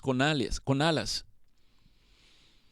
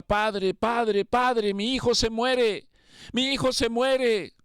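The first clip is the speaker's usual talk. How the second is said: retold pleading cry, pitched higher and building; desperate cry; 7 semitones higher, slower than usual. A father outside the hospital begging for his son, pitched-up pleading cry. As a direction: cry out to God for a dying son. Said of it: "le gritaba y le decía".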